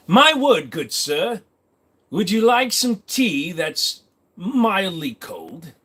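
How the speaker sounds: mock British accent. Streamer plays a Brit facing a thief, mock British accent. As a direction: put on a swooping British accent. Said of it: "as a Brit, I can't even say an insult back".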